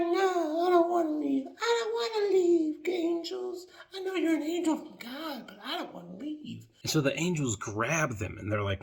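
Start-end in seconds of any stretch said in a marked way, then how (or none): 0.0-6.7 whiny refusal. Retold or imitated. Lot begging the angels to stay, high whiny whine. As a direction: whine like a child who won't leave.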